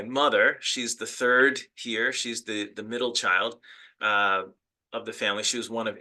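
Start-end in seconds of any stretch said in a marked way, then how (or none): none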